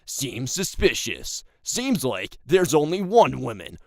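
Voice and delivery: loud, swooping conspiracy theorist; stupid conspiracy voice. Acted. Conspiracy theorist proving women are not real, silly conspiracy voice. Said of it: "that stupid-ass, like, conspiracy voice".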